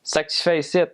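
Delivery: high, slurred-together Quebec French; Quebecois French. Shown as a demonstration. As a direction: run it together in a Quebec accent, T on the end. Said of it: "it sounds more like".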